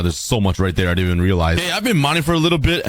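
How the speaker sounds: ordinary talk, nothing marked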